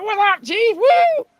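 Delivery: hammed-up greeting; mock cheery. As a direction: fake cheery greeting to the chief. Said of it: "I said".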